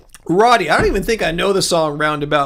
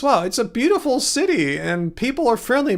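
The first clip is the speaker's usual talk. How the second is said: ordinary delivery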